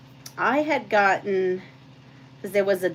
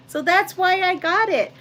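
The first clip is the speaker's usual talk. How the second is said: high, loud emphatic finish; 11 semitones higher, 4 dB louder than usual. Real, not acted. Explaining why she bought the channel, high and emphatic. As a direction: land the point with a lift.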